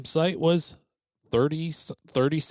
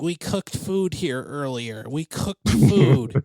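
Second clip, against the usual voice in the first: slow, higher, insisting over and over; indignant old woman; 3 semitones higher, 4 dB louder, slower than usual. Retold or imitated. Old woman insisting she cooked at the campsite, indignant. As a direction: drag out the complaint, louder at the end. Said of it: "And she's like".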